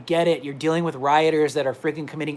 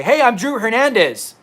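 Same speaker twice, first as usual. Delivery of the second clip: higher, louder hurried call; calling through the door; 6 semitones higher, 5 dB louder than usual. Retold or imitated. Imagined calling to guards through the cell door, louder hurried. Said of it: "knock on the damn door and say".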